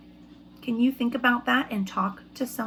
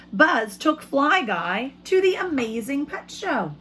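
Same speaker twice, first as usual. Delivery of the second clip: put-on reading to children. Teacher reads picture book aloud.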